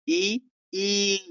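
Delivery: ई held long with the tongue pushed forward; long ee demo. Shown as a demonstration. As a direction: stretch out ई. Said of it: "जीभ आगे उठती है". (जीभ आगे करके लंबा ई)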